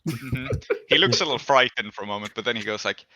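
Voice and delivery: ordinary talk, nothing marked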